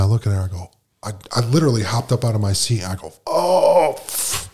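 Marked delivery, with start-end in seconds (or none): 3.3-4.5 dismayed cry and puff. Retold or imitated. Shocked at the news.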